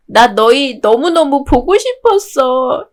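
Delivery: half laughing, half crying; laughing through tears. Acted. Girl thanks her friends, laughing through tears. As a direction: smile through the tears as you say it.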